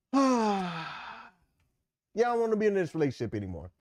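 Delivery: bored partner leaving. Retold or imitated. A spouse quitting the marriage after the itch, sighing and bored. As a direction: toss it off with a weary sigh. Voicing the partner who leaves.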